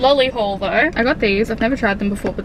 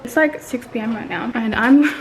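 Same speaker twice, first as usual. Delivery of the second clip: ordinary delivery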